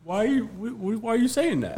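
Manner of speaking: high, startled why are you saying that; sudden high-pitched protest; shocked protest. Real, not acted. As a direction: act stunned and offended. Protesting the insult.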